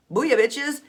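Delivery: high, loud playful booyah; triumphant cheer. Pretend. Teacher celebrating that listeners just hypnotized themselves, loud triumphant cheer. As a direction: crow it in triumph.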